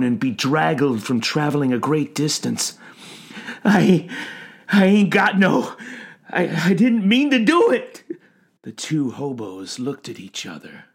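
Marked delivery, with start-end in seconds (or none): 3.6-8.0 high, loud stammering plea; anguished old man protesting; distraught pleading. A narrator's reading. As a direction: stammer out a tearful denial. Sobbing stranger pleads innocence.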